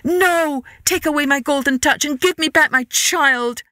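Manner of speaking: high, emphatic begging; distraught king's cry; desperate plea. A narrator's reading. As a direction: implore the god with all urgency. King begs god to lift gift.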